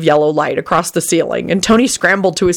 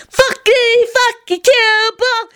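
joyful holler; much slower than usual. A narrator's reading. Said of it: "Annie hollered joyfully".

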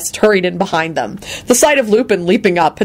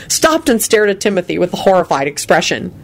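ordinary delivery

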